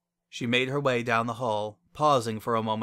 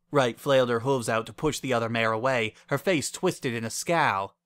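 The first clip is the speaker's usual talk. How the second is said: ordinary delivery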